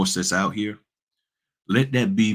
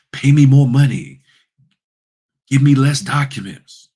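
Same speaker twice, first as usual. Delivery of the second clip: louder, higher pushy demand; mock demanding; 6 semitones higher, 10 dB louder than usual. Notary mimics people who want others to go above and beyond, mock demanding. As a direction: mimic an entitled demand, loudly.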